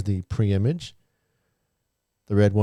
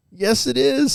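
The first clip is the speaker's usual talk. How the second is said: excited; 14 semitones higher, slower than usual. Acted. Excited confirmation.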